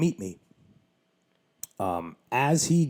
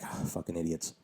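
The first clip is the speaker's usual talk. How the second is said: softer, under the breath; muttered thought; 4 semitones lower, 9 dB quieter than usual. Retold or imitated. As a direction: grumble it to yourself, softer. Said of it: "In my head".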